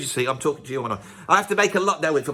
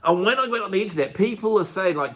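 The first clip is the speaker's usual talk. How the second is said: ordinary talk, nothing marked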